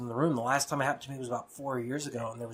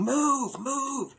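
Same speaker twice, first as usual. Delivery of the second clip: retold scream; 13 semitones higher than usual. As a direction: scream it in a high, held-back voice. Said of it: "and I'm screaming".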